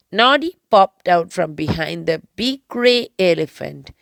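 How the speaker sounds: usual speaking voice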